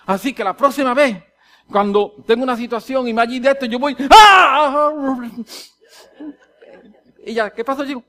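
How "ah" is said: loud, high yell cut short; acted bellow of frustration; stifled outburst. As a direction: start to explode, then rein it in. Acts holding back his shouting.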